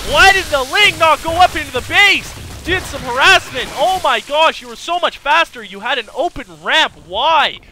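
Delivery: loud, high, fast hype over the fight; excited shouting. Real, not acted. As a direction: yell it like the fight just broke out. Caster hyped by a fight.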